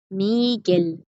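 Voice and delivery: "Miguel" ending on a clear L, not R; final L. Shown as a demonstration. Teacher confirms for students that it is L, "Miguel" with final L. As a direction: finish "Miguel" on a held L sound. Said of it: "Pues aquí es una L".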